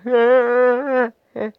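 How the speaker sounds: high, breathy gasping sobs as if waking in tears; imitated waking sobs. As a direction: re-enact the crying gasps on waking. Waking up crying from dream.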